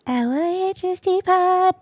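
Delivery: high, slow announcer call. Pretend. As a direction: boom out the show name. Sung-out show handle.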